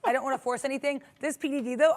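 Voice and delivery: ordinary delivery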